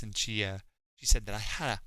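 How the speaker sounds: ordinary talk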